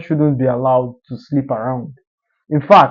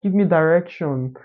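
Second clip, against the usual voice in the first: put-on Lagos island girl accent; 3 semitones higher, 4 dB quieter than usual. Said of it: "Tush accent where they'll be like".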